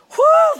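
high, loud "whoo" of relief; sudden whooping exhale; relieved whoop. Real, not acted. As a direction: let out a big whoo. Exclaims relief after reading verse.

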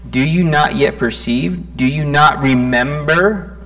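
loud, impassioned scripture reading, voicing Jesus; preaching at full force. Preacher voicing Jesus rebuking the disciples, loud and impassioned.

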